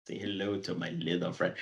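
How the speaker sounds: put-on low, soft Cuban-accented movie quote. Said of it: "You do the accent pretty well".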